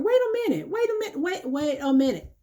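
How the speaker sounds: sudden high wait a minute, cutting them off; exclaiming wait. Acted. Preacher stopping a member who runs to pastor, high urgent interruption. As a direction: throw both hands up and stop them mid-sentence.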